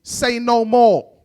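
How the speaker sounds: slow, stretched-out mock roadman line; exaggerated street-kid sign-off; imitated London youth. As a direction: draw each word out like a cool youth. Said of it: "Then he goes".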